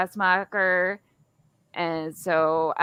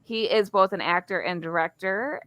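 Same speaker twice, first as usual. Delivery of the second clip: ordinary talk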